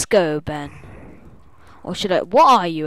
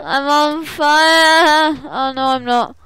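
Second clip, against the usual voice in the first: high, loud and stretched out; mock-panicked wail; 8 semitones higher, 7 dB louder, much slower than usual. Player still burning in the game, drawn-out mock panic.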